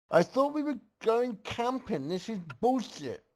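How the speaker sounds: high, whiny complaint; yelled. A narrator's reading. Twydos complaining about the camping trip, high gripe. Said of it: "Twydos yelled out".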